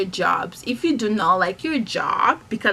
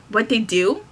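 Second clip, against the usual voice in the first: ordinary talk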